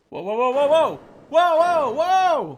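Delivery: loud, rising startled whoa; loud shocked whoas. Real, not acted. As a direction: react in loud disbelief. Shocked at sudden twist.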